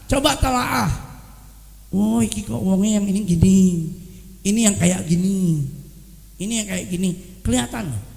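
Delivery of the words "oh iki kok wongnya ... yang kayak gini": thoughtful observer. Retold or imitated. Careful observer judging witnesses.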